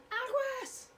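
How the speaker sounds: high urgent warning call; warning yell. Shown as a demonstration. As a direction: call out the danger. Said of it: "Get away from the cliff".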